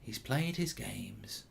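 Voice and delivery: hushed, slow voiceover, sinking near a whisper; very soft, drawn-out solemn commentary; attempted impression. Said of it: "I can't do it".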